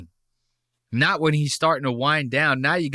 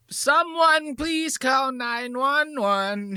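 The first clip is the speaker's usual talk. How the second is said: very high, slow whiny mock voice; mock plea for help; 12 semitones higher, much slower than usual. Streamer mocks the guy being yelled at, whiny plea. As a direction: whimper it like a scared man.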